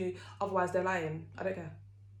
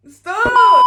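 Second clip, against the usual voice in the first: sudden shout; 23 dB louder than usual. Acted. Sudden outburst mid-intro.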